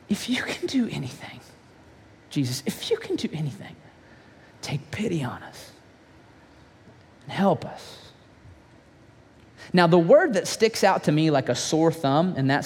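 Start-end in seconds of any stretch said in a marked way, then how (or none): 0.1-8.0 soft, unvoiced whisper, pleading; quivering plea. Tearful father begging Jesus for pity, whispering. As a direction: whisper a broken plea for help. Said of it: "the dad's voice begins to quiver".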